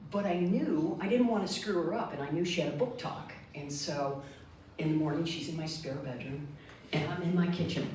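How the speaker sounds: usual speaking voice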